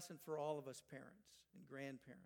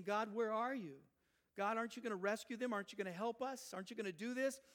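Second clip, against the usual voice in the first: higher, louder pleading questions; worried parent demanding God's help; anxious pleading; 5 semitones higher, 5 dB louder than usual. Acted. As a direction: plead with God in rapid questions. Voicing anxious parents pleading with God.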